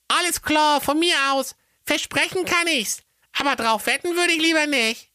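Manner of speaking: put-on very high cheeky child voice; squeaky kid, casual; child's voice. A narrator's reading. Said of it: "so antwortete Klappstuhl".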